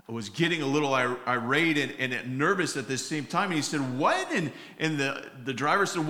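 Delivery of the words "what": a sudden high, startled "What?"; shocked what. Retold or imitated. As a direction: jolt up high in disbelief. Cop hears about body in trunk.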